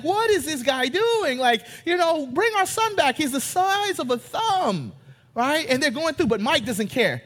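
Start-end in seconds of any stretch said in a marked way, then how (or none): 0.0-4.9 higher, frantic parents; mock-worried mom and dad; a hint of frantic parents; panicked parents. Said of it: "They're like".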